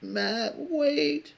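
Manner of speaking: high, loud mocking call; hammed-up plea, pitch leaping up on the last word; pathetic stage voice. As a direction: mimic her theatrical shout after him. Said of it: "fucking pathetic stage voice".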